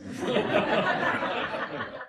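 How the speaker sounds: chuckle, pleased with himself; amused chuckle. Acted. Amused by his own joke.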